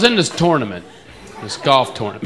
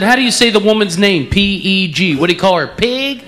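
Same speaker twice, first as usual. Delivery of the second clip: louder, higher mock of Texan "pen" as "pin"; jab him by saying "pig"; 5 semitones higher, 4 dB louder than usual. Comic teasing Texan about pronouncing "Peg", louder and higher. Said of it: "I said".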